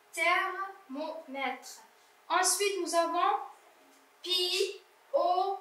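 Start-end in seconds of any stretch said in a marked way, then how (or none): 0.1-1.8 Thermomètre drawn out very slowly and softly; teacher softly repeating Thermomètre; slow soft modelled reading. Shown as a demonstration. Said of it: "teacher repeats word slowly".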